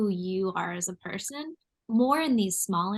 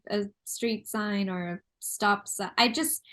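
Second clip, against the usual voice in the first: ordinary delivery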